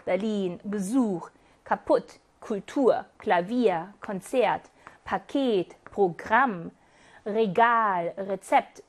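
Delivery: Büro, Beruf, Berlin and each following word stressed on its second syllable; low-pitched word list from Büro to Termin, each leaning on the last syllable; stress on final syllable. Shown as a demonstration. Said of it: "음절인 이음절에 강세가 오는 경우 읽어보고 갈까요?". (Büro부터 Termin까지 둘째 음절에 강세를 두고 또박또박 읽기)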